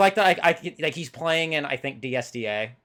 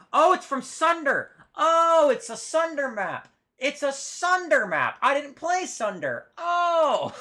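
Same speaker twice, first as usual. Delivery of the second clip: much higher, delighted outbursts; excited realization; 12 semitones higher, slower than usual. Real, not acted. Cohost realizes the map comes from Sunder, excited. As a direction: shout out the discovery with glee.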